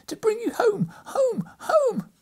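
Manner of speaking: put-on very high, loud, joyful little girl; gleeful child. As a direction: squeal it with childish delight. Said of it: "bending down to laugh".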